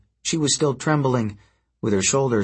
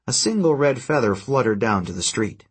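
ordinary delivery, nothing marked

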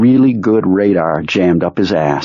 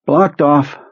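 high, slow, drained voice of a sick man; sick psychic Perlmutter; 7 semitones higher than usual. Acted. Ill telepath reporting to his boss, high and drained. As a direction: sound weak and washed out.

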